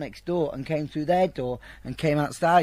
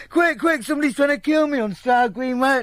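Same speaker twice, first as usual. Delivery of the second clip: much higher, louder frantic plea; mock a terrified man on the phone; imitating panic; 10 semitones higher, 5 dB louder than usual. Man retells panicked phone call.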